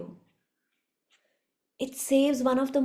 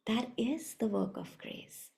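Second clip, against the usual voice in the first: quiet echo; 10 dB quieter than usual. Shown as a demonstration.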